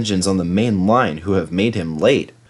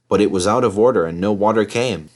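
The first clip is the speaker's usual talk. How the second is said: ordinary delivery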